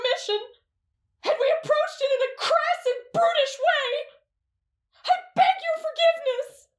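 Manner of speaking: put-on a teen boy grovels to his teacher, wailing; wailing apology. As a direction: beg forgiveness through sobs and a nervous laugh.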